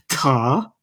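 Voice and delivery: TA with a rising, querying tune; questioning game cry. Retold or imitated. Imitating the protagonist's special attack, questioning TA. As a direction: mimic TA as a one-word question. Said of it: "like he's asking a question".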